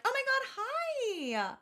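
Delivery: high, slow gushing hello; excited cat voice. Retold or imitated. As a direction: voice the cats thrilled to meet a new friend. Cats voiced greeting the doll.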